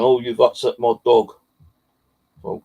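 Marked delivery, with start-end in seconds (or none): 0.0-1.3 loud, raised-pitch retold complaint; irate complaint. Landowner complaining to the walker about his dog, loud and irate. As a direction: imitate an annoyed dog owner, loudly.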